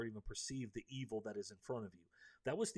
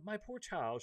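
higher, honeyed, coaxing; suddenly sweet witch; 8 semitones higher, slower than usual. A narrator's reading. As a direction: sweeten the voice like a witch luring a boy. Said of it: "she said in a quite different voice".

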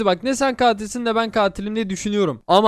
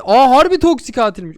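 surprised; 4 semitones higher, 7 dB louder than usual. Real, not acted. Discovers friend is the killer.

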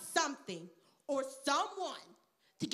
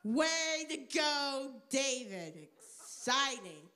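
mock enthusiasm; 2 semitones lower, slower than usual. Teacher cheering David the future king, with mock enthusiasm. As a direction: feign excitement with little energy. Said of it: "You can see my enthusiasm".

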